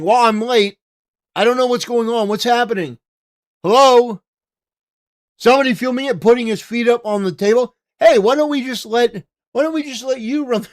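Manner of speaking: hammed-up whiny latecomer asking what he missed; teasing a late chat viewer in a mock clueless voice. Mocking a late chat member.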